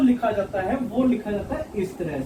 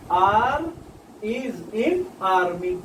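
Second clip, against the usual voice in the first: very slow, words drawn out; slow demo; much slower than usual. Shown as a demonstration. Teacher modelling the R sound for students, very slow. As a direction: stretch each word of the example.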